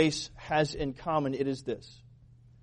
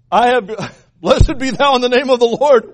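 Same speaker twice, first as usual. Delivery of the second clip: loud, higher mock-holy proclamation; exaggerated sanctimonious hail; hammed-up pious greeting; 5 semitones higher, 8 dB louder than usual. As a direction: boom it out with false piety. Replays Saul's pious greeting.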